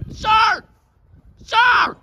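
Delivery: high, loud shout to get his attention; shouting sir. Real, not acted. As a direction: bellow across the ring at him. Commentator yells at wrestler.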